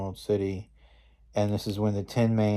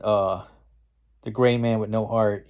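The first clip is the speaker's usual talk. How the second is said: ordinary delivery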